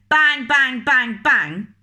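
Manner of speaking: loud, high shouted knocks; loud bang. Acted. Reader shouting the postman's loud knocking. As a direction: pound the door with your voice.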